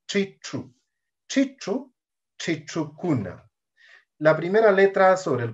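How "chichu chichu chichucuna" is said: "Chichu" with a non-Spanish, curled-back ch; tongue back for each ch in "chichucuna"; retroflex ch. Shown as a demonstration. Teacher showing the sound Spanish lacks, retroflex ch. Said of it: "en cambio esta no. Este sonido no".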